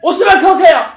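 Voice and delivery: high, urgent name calls; shouting to players. Real, not acted. Fan calling Barış, Osimhen and Icardi on, urgent shouts. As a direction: call out loudly across the pitch.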